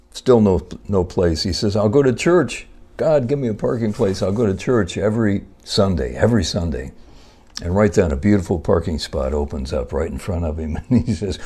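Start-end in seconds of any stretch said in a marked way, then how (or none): 1.8-6.9 mock-solemn vow to go to church every Sunday; fervent vow. Driver makes his final offer to God, hammed-up fervent vow.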